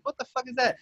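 high, amazed question; retold disbelief. Staring at a moving dot in the sky, stunned. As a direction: pitch it up in shock. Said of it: "I'm like".

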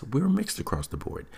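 ordinary talk, nothing marked

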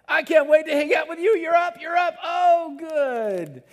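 thrilled shouting welcome; overjoyed God voice. Acted. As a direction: shout with delight that they're up. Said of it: "sitting on the edge of his seat going".

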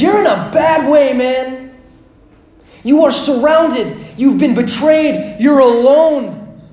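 loud, high, urgent pleading; a high, loud plea from God to a lonely king. Acted. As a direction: plead with him, rising as you go. God voiced with rising urgency.

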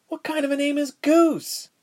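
put-on very high, baffled girlish question; puzzled little girl's voice; incredulous. A narrator's reading. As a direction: question the name in disbelief, pitch way up. Mouse questions the silly name.

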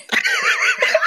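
high-pitched burst of laughing; helpless laughter. Real, not acted. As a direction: crack up at your own slip. Laughing at own slip.

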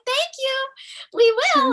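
very high, bubbly mock cheer; giddy fan voice. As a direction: imitate giddy fans. Mocking cheerful fans.

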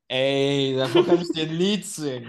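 loud, high, drawn-out outburst; big drawn-out "I know all about it"; emphatic exclamation. Real, not acted. Knows this all too well, exclaims.

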